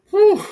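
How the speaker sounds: high, loud relieved whew; whew of relief. Real, not acted. Speaker recalling almost going on stage naked, loud relieved whew. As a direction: blow out a big whoosh of relief. Relief after near miss.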